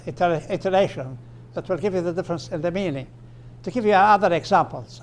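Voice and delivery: normal talking voice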